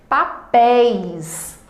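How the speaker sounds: "Papéis" stretched very slowly, open "é" and the "i" glide held; slow demo word. Shown as a demonstration. Teacher sounding out the example word so students hear the diphthong. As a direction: spell out "Papéis" by ear, syllable by syllable. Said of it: "Perceba que na pronúncia".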